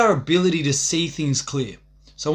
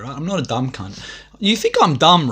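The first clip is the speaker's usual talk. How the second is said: ordinary talk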